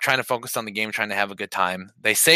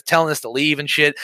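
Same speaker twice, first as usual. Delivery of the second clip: usual speaking voice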